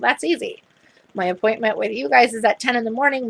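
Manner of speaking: ordinary delivery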